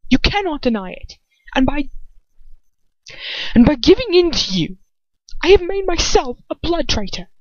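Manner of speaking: rising anger as he accuses her; furious. A narrator's reading. Lucius accusing her, voice climbing in fury. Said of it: "he mutters furiously".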